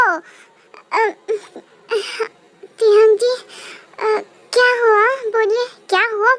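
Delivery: waking in tears, voice sobbing; tearful and scared, asking what happened; crying. Acted. Woken bride crying. (रोते हुए घबराकर पूछना)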